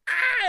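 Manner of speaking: put-on very high delighted baby squeal. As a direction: squeal like a thrilled infant. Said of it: "he just squeals in delight".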